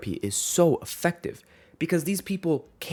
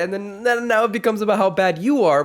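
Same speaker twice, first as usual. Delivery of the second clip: loud exasperated; 8 semitones higher, 7 dB louder than usual. Real, not acted. Rant peaks.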